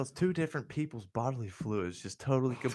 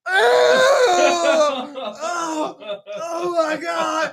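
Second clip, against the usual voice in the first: high, wailing mock scream; imitated horrified yells, drawn out; disgusted screaming; 13 dB louder, much slower than usual. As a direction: shriek like a crowd just sprayed. Spat-on crowd screams in disgust.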